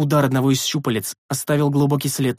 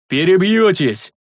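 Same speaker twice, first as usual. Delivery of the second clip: higher, louder, slow thunderous bark; booming refusal; 5 semitones higher, 6 dB louder, slower than usual. A narrator's reading. Rikar thunders his refusal at the sentries, booming. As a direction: boom it out to shut them up. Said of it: "громогласно повторил здоровяк".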